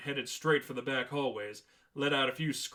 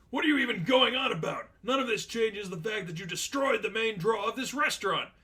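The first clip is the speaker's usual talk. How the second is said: higher, louder exasperated rant; 9 semitones higher, 5 dB louder than usual. Acted. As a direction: vent at this madman.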